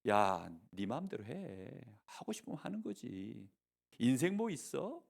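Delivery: the devil murmuring a lazy temptation; sly devil. Acted. Preacher voicing the devil coaxing children, low and soft. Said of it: "이 속임수를 가지고".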